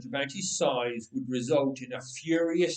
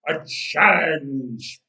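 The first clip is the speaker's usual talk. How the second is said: slow, drawn-out roaring challenge; 8 dB louder, much slower than usual. Acted. Giant roaring at the enemy army, slow and loud. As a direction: roll the word out like a giant's roar. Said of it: "he would roar".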